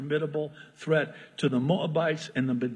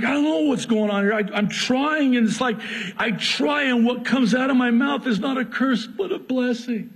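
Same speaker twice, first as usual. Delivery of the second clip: flustered Balaam; 7 semitones higher, 3 dB louder than usual. Acted.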